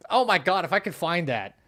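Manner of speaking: usual speaking voice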